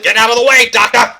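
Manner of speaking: high, very loud command; shouted order. Acted. Detective pushing past the doctor, shouting. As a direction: bellow to clear the path. Scuffle over the clock.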